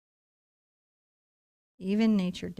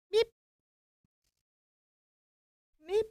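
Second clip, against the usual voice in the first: short high-pitched beep for each dab; tiny squeaked beeps, cleanly separated; high little beep; 13 semitones higher than usual. Shown as a demonstration. Painting teacher showing how to tip the brush, high beeps.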